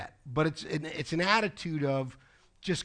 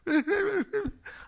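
high-pitched imitated sobbing; mock crying. Imitating Kyle bursting into tears, mock crying. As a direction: put on high little boo-hoo sobs. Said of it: "She starts crying".

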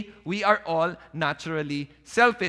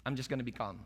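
much softer, lower, settling the argument; backing down calmly; 8 semitones lower, 12 dB quieter, faster than usual. Acted. One side backs down to end the shouting, soft and calm. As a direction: let the fight go out of your voice, softly. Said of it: "someone has to back down and say".

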